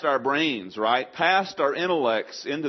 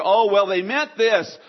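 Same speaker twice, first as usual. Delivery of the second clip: higher, louder, drawn-out objection; mock quibbling; 9 semitones higher, 9 dB louder, slower than usual. Arguer twisting scripture's meaning, mock whiny quibble. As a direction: play a nitpicker hedging. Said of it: "There's almost no way to argue".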